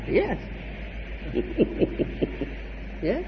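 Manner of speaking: usual speaking voice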